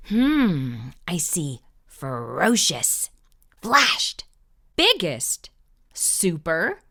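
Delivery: ordinary delivery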